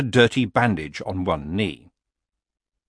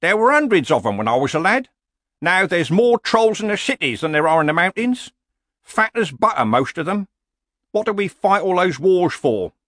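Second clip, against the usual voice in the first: put-on higher, emphatic old warrior; ranting old barbarian; 10 semitones higher, 4 dB louder than usual. Old barbarian laments vanished bridge trolls, ranting. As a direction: complain like an indignant old-timer. Cohen rants about trolls.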